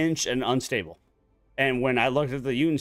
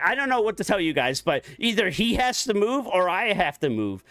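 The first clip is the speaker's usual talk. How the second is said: higher, faster, lightly acted ultimatum; whiny coworker; 7 semitones higher, 3 dB louder than usual. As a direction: mimic his huffy demand. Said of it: "So he was just like".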